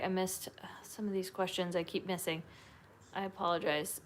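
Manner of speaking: soft unvoiced apology; hushed sorry while catching up; whisper. Real, not acted. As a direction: whisper it sheepishly. Whispered apology mid-stream.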